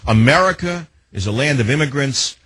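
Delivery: ordinary talk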